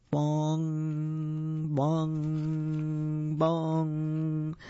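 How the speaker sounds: slow, even, ringing bongs; clock chime. Acted. Clock strikes midnight in story.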